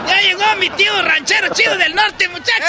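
high joyful shouts; excited shouting. Acted. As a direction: call out the good news. Kid announcing uncle's arrival.

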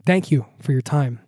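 ordinary delivery

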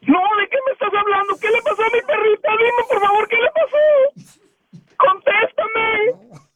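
desperate, pleading distress; angustia real. Real, not acted. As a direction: demand answers in rising, stretched-out cries. Dueño engañado cree atropellada su perrita. (súplica angustiada, casi llorando)

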